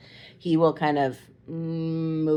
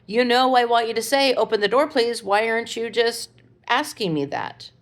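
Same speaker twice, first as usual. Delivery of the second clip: insistent raised voice; 6 semitones higher, 3 dB louder than usual. Retold or imitated. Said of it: "And I'm like".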